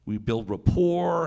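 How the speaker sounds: usual speaking voice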